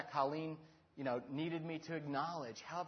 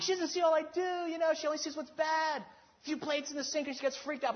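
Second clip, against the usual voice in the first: high-pitched complaining rant, loud at first; husband venting to his buddy, high and whiny; 11 semitones higher, 4 dB louder than usual. Retold or imitated. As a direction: pour out grievances in a whiny high voice. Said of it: "I just kinda started vomiting".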